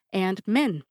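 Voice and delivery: ordinary talk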